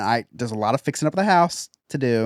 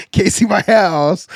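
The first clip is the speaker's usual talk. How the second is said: ordinary delivery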